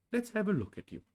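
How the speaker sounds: high Pooh bear voice. Acted. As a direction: brightly offer to help.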